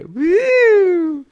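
high, loud falsetto "woo"; imitated taunting whoop. Runner showing what he did, high whoop. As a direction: repeat the obnoxious whoop in falsetto. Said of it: "that's what I did".

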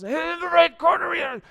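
loud, high ring announcer call; stretched "blue corner" announcer cry; fight announcer. Retold or imitated. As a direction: boom it out like the MMA announcer.

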